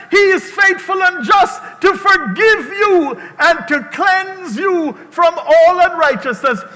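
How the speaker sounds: high, loud preacher's shout; full-force preaching. Acted. Pastor asks congregation for a witness, fervent loud preaching. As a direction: preach it hard, pitched well up.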